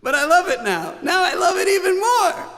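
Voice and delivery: very high, loud mock gushing. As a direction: overflow with hammed-up love for it. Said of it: "I was like".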